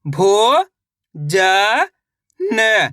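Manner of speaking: each syllable said slowly and apart. Shown as a demonstration. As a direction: sound out each syllable with pauses between. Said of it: "देखिए किस तरीके से".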